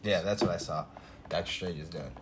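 ordinary delivery

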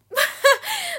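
squealing laugh at herself; high laugh. Real, not acted. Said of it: "I sound eight".

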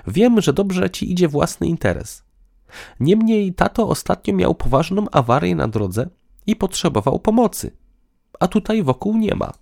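high-pitched female character explaining; earnest woman. Acted. Woman tells partner about father's breakdown, earnestly. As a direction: make your case in a high woman's voice.

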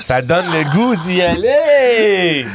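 high, drawn-out hammed-up plug; mock sales enthusiasm. Comedian mock-selling the show to listeners, drawn-out high hype. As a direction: stretch it out high, laughing as you oversell.

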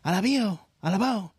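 high, loud mock chant of the mother; mother cheering back at her daughters; high excited cheer. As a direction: cheer it like a mother bursting with joy.

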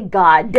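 "God" with a hard, released D at the end; punched final consonant. Shown as a demonstration. Explaining why "God" sounds good, final D punched. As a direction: say "God" loudly, landing on the final D. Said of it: "it's that consonant at the end".

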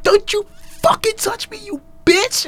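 high, far put-on yell; scared and angry. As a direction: snarl as if cornered. Voicing Yusuke's outburst.

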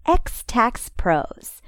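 upbeat radio ad voice; ad read. Acted. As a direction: read it like a sponsor spot. Sponsor ad read.

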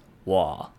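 我 low and dipping; third tone. Shown as a demonstration. Teacher giving words by themselves, 我 in a low third tone. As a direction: let 我 sink low in the third tone. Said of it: "in the third tone means me or I".

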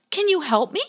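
Pie Jam pleading. Acted. Pie Jam asks the otter for help, high hopeful voice. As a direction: ask for help eagerly. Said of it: "said Pie Jam".

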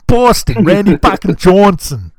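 loud, high Boston accent. Shown as a demonstration. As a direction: punch it out like a Boston sports fan. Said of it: "That's the best way to do a Boston accent".

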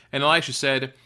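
ordinary talk

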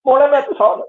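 put-on high, loud greeting in a Northern Irish accent; local Northern Irish accent. Greeting people who assumed no English, in broad local accent. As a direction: call out a hearty local Northern Irish hello. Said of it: "I'd have this accent".

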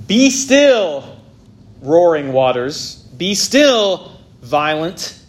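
booming divine command; commanding rebuke. Shown as a demonstration. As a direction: rebuke the raging nations. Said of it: "God is confronting the rebellious nations".

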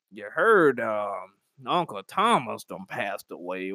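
put-on low, slow elderly voice with long pauses; old relative gossiping. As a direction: drag it out like an old auntie breaking news. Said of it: "everybody's up in age talking about".

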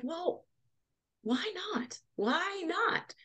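high, soft, wondering voice; musing reconsideration. Retold or imitated. Talking herself into starting a business, musing reconsideration. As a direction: let it float up high and light, tempted. Retold change of mind.

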